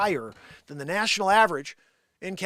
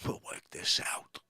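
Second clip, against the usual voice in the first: whisper; 9 dB quieter than usual. Acted. Bronx character conspiring.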